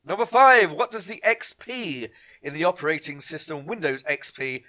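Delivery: loud, punchy quiz question read-out; quizmaster announcing. Acted. Quiz host announcing the next question to the players, loud. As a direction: call it out like a pub quiz host.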